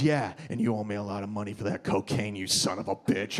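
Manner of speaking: low, level accusation; menacing bouncer. Acted. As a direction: confront him as a bouncer, voice dropped low. Acted bouncer accuses him over money.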